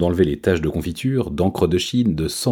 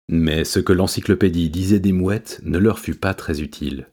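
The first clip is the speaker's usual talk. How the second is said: usual speaking voice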